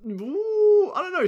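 high, loud teasing disbelief; high doubting ooh. Real, not acted. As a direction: squeal it, doubting. Disputing who is more buff.

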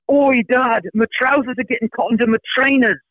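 put-on high British child calling Oi Dad; British kid yelling. Son yelling to dad at the bus, high British accent. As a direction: shout like a little London boy. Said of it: "I hear him yelling from behind me".

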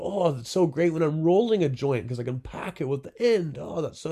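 high, loud imitation of a raving buyer; enthusiastic customer. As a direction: rave like a thrilled customer. Voicing impressed customers.

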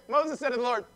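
ordinary talk, nothing marked

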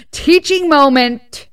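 loud, slow, pointed jab at the fathers; mock lecture to the dads; sarcastic scold. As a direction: drag out the dig. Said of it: "I'm just like".

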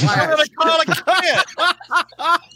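high, slow, laughing disbelief; laughing mockery. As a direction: laugh it out slowly in mock disbelief. Mocking Spider-Man's choice, laughing.

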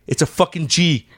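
ordinary talk, nothing marked

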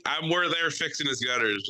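ordinary talk, nothing marked

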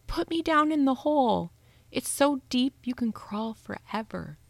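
put-on a man faking a small child, unsettling; creepy child voice. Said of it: "a man voice doing an impression of a little kid".

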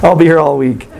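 chuckling at her own bad accent; laughing. Real, not acted. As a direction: laugh it off after the joke.

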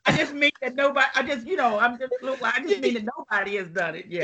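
fast, stumbling backpedal; flustered rush. Acted. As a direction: tumble out the words, panicking. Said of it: "was I about to say that out loud".

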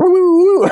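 high whooping police siren; siren noise. Retold or imitated. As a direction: whoop like a cop car siren.

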